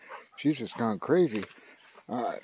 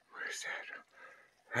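barely-there whispered question; very soft, voiceless wondering; hushed whisper; 18 dB quieter than usual. Real, not acted. Wondering at a noise nearby.

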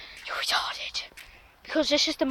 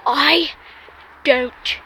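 emphatic, with a pause after each word; 3 dB louder, much slower than usual. Real, not acted. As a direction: punch out each word separately.

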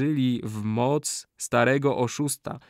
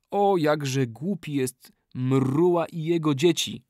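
slow, higher taunting cry; mild mock shout; 4 semitones higher than usual. Kali mocking the chief and his people, mild shout. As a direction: jeer at the chief, lightly shouted. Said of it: "krzyknął Kali".

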